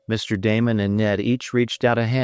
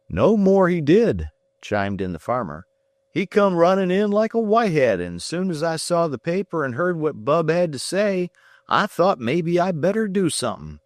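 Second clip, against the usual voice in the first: lightly voiced rustic farmer, high; a plain country man vouching for his boy; 10 semitones higher than usual. A narrator's reading. Farmer backs up his son's story to Ned.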